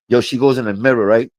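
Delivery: ordinary talk, nothing marked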